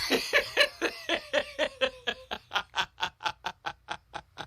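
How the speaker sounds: long, high giggling fit; helpless laughter. Real, not acted. Storyteller breaking up at the microscope attack, helpless laughter. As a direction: crack up mid-story.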